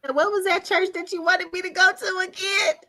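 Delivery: high, squeezed mock-pleading voice; playful high-voiced retold question; acted sheepish question. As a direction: pitch it way up, comically asking a favour. Jokingly asks friend about church.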